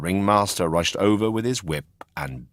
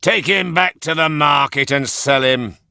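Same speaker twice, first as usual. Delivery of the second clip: gruff ringmaster; 8 semitones higher, 7 dB louder than usual. A narrator's reading. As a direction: bark it like a fed-up circus boss. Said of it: "said the ringmaster".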